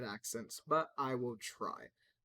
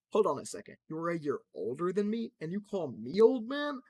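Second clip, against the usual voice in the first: indignant character; 6 semitones higher, 8 dB louder than usual. Acted. Crypto protesting at being called old, high and loud.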